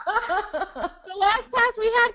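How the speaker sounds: real laugh running into the words; high-pitched, amused, talking through laughter. Real, not acted. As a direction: start the story while still laughing, high and slow. Laughing at listener's quip.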